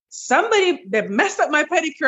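high, puzzled complaint; mock outrage over the pedicure; shocked at the tags. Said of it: "I was like".

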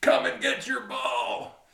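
high, slow drawn-out holler; distant yell. Retold or imitated. Big guy to the golfers from down the fairway, yelling. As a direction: call it out high, as if from far down the fairway. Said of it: "and he yells".

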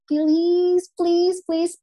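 high, begging please; pleading. Retold or imitated. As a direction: plead over and over. Friends begging her to teach.

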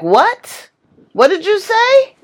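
high, sharp outraged parent; stern parent. Retold or imitated. Mother scolding a child who answered what, outraged. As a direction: bark it back like an offended mother.

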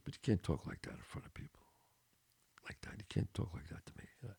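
barely voiced, confidential reproach; retold whispered warning. Made friend rebuking his buddy privately, whispering. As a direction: drop to a whisper to put him in his place.

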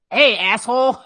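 acted parental shout; blunt angry parent. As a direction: holler the insult like a fed-up parent. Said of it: "and just said".

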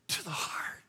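soft whispered ending. Acted. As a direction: let the words fall to a hush. Preacher stressing emotional wound.

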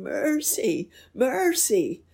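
crying for mercy. A narrator's reading. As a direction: cry out begging on your knees.

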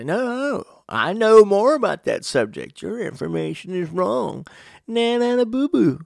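put-on high, taunting kid voice; sing-song playground gloating, pitched well up; mock childish one-upping. Child combating another child's information, mock taunting.

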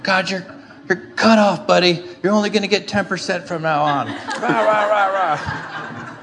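high, strained mock outburst with roaring rahs; worked-up mock rant. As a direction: rage like Donkey Kong, hammed-up. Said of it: "like Donkey Kong in a cage now".

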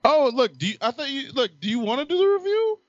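surprised. Real, not acted. Caught off guard, pitch jumps.

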